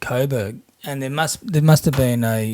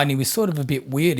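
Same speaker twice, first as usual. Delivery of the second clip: normal talking voice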